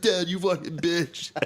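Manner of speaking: put-on high, drawn-out teenage taunt; comic whiny challenge to a parent; mock angry outburst. Drunk son coming downstairs at dad, mock angry outburst.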